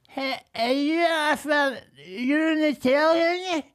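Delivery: a hammed-up gushing fan spotting a celebrity; mock excited fan. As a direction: squeal it like a starstruck drunk. Imitating fan who recognised him.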